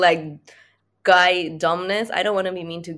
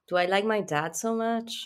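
normal talking voice

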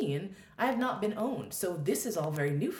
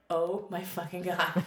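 slow, drawn-out exasperated groan of words; retold exasperation; slower than usual. Would-be meditator at chanting kids, mock exasperation. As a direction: stretch it out in mock disbelief.

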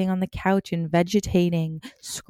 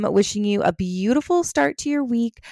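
usual speaking voice

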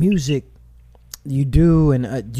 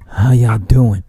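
imitating a lower, louder "How y'all doing" like a rapper; mock deep voice; 6 semitones lower, 6 dB louder, slower than usual. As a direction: do the guy's deep, puffed-up greeting, lower and louder. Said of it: "Like, mad deep".

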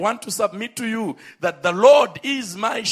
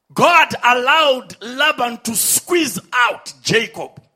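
loud, slow, shouted preaching; fervent preaching; 4 semitones higher, 6 dB louder, slower than usual. Real, not acted. Preacher shouting to the congregation about Laban, loud and slow. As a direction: drive it home to the crowd.

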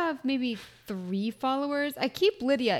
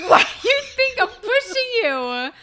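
high, loud comic frustration; mock exasperated yell; 10 semitones higher, 6 dB louder than usual. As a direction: yell at a blocking follower, half laughing. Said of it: "And it's like".

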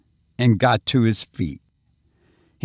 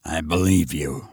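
gruff commissioner; 7 semitones lower, 3 dB quieter than usual. Acted. Commissioner's low voice.

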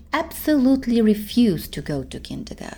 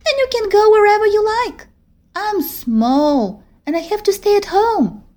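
put-on higher, pouting little-girl voice; a little girl envying her big friend; small girl voice; 12 semitones higher, 6 dB louder than usual. Teddy's voice in the story.

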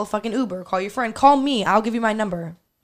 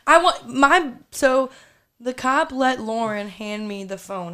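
usual speaking voice

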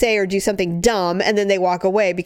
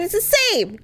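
normal talking voice

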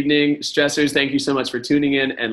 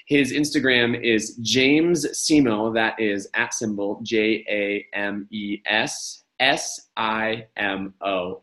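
slow spelling; slower than usual. Shown as a demonstration. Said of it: "spelling out handle".

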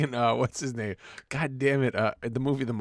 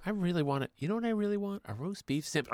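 higher and softer, a teasing fake seduction; mock breathy come-on; 8 semitones higher, 7 dB quieter than usual.

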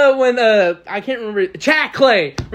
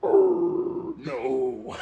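possessed voice; 8 semitones higher, 6 dB quieter than usual. Retold or imitated. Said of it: "like the devil possessed him".

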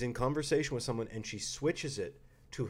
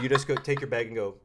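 normal talking voice

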